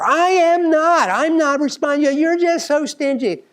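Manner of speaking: high, loud, slow mock yell at mom; shouting back. Student recalling how she'd yell at her mother, loud mock yell. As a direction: hurl it back like a furious daughter. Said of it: "I would have shouted back at her".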